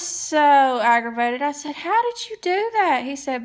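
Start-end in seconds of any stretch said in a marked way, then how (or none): 1.8-3.0 higher, incredulous retold question; mock disbelief, pitched up; amazed question. Asking husband how he fixed it, higher and amazed.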